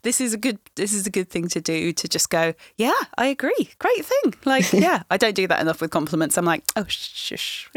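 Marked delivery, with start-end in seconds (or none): 2.8-4.3 high, chirpy mock agreement; lilting put-on agreement; breezy self-approval. Models happily accepting a compliment. 6.8-7.7 soft whispered mock shushing; hushed, coy dismissal; bashful brush-off. Retells brushing off a compliment.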